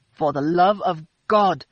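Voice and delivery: loud, slow angry outburst; exploding. A narrator's reading. Philip blowing up at Christina, slow loud outburst. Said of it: "Philip exploded".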